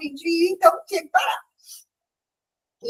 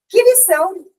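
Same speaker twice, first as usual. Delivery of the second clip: exclaiming; 6 semitones higher, 5 dB louder than usual. Real, not acted. Exclaims in wonder.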